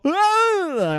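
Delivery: very high mock girly scream. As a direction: shriek like a scared girl. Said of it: "Pure girl".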